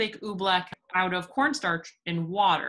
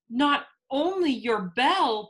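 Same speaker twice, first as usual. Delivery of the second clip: higher, louder, urgent storybook cry; acted urgent outcry; 6 semitones higher, 6 dB louder, slower than usual. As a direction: call it out in alarm, drawing the words out. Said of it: "Bartholomew cried".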